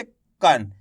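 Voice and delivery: कन said with a half na at the end; कन as k plus short a plus nasal n; teacher sounding out. Shown as a demonstration. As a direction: sound out कन slowly and clearly. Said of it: "कैसे".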